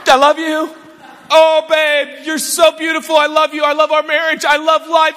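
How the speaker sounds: high strained gushing on held breath; tight, pent-up mock adoration; holding it in. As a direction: gush lovingly while sucking in your gut. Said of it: "I'm holding it in, man".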